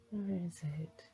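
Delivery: ordinary talk, nothing marked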